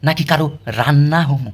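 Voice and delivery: ordinary talk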